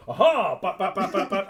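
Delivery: loud triumphant cry, then rapid hits; play-fighting sound effects; mock attack noises. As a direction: pop out each imitated blow. Acts out striking his opponent.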